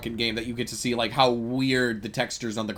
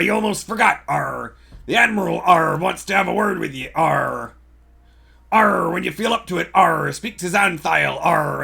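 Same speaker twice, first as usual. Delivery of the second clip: hammed-up pirate; 4 semitones higher, 6 dB louder than usual. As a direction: play an over-the-top sea dog. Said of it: "I was too piratey".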